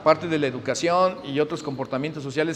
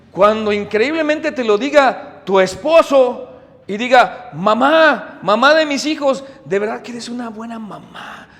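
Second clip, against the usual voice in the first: preaching climax; 5 semitones higher, 8 dB louder, slower than usual. Acted. Preaching climax about husband's praise.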